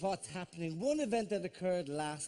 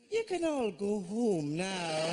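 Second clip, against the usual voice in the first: deadpan announcer; slower than usual. Retold or imitated. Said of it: "And then a voice goes".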